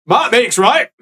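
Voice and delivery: hammed-up grumpy priest voice; gruff angry old priest. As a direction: bellow like an outraged old cleric. GM voices angry Father NPC.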